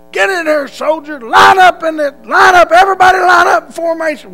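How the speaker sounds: high, loud barked orders; a drill sergeant yelling at new recruits on arrival. Retold or imitated. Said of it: "they was hollering and screaming".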